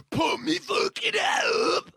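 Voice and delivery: very high slow strained yell; high shout. Pretend. Exaggerated reaction.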